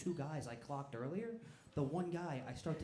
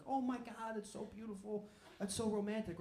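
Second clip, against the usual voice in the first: put-on gushing girlfriend; 6 semitones higher than usual. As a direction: coo it in a higher girlfriend voice.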